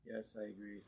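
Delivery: quiet, low, underwhelmed reply; lukewarm assent. Acted. Subject answering when the king walks in, limp and quiet. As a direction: agree limply, with no force at all. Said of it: "you would say".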